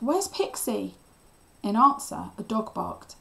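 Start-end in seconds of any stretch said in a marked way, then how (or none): 0.0-1.0 urgent, higher-pitched question; mildly raised, demanding to know; yelled question. A narrator's reading. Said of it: "I yelled, reaching Cliff".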